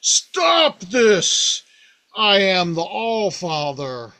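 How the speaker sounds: slow, proud command to halt; commanding god. Retold or imitated. Allfather confronting the mice, slow booming command. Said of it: "steps forward and goes".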